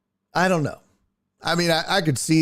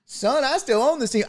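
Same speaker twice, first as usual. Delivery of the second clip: higher, lightly voiced old owner; mock Jerry; 8 semitones higher than usual. Host playing Jerry reminding Dion he owns the team, lightly voiced. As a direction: pull rank like a cranky old boss. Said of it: "And then Jerry goes".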